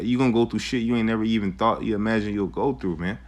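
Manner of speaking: ordinary talk, nothing marked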